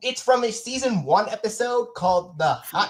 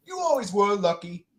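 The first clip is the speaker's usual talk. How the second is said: ordinary talk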